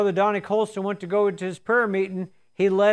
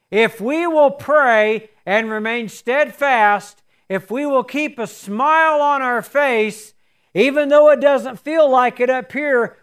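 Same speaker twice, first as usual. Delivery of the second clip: rising sermon; 3 semitones higher, 4 dB louder than usual. Acted. Preacher urging the congregation, building force. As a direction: drive the point home to the congregation.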